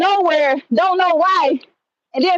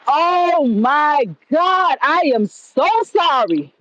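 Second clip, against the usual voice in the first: slow, drawn-out shocked condolence; stunned apology; much slower than usual. Retold or imitated. Man apologising to his bereaved coworker, stunned and slow. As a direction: stretch out each word in retold shock.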